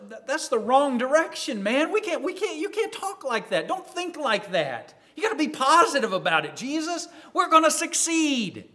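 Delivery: high-pitched, stammering objection; urgent protest. Acted. A disciple urging Jesus to be positive, protesting.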